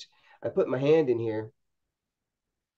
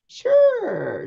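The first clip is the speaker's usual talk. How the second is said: sudden high-pitched sure, a bit louder; startled high yelp; 5 dB louder than usual. Retold or imitated. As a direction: jump up in pitch, caught off guard. Skeptical mom suddenly convinced.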